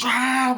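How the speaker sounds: high, loud burst; sudden hammed-up shriek; mock attack yell. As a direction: imitate a sudden knife lunge. Killer lunges with knife.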